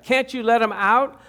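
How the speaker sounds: pleading cry. Acted. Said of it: "I cried at the sight".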